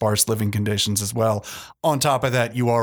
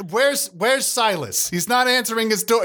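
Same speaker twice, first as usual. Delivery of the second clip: frustrated demand; 8 semitones higher than usual. Acted. Longgrass demanding Silas's whereabouts.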